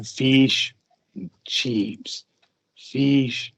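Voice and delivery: slow, drawn-out "fish" with a careful i; practicing "fish and chips" word by word; practicing pronunciation. Retold or imitated. Kiwi practicing the word alone, slowly. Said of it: "Goes around practicing".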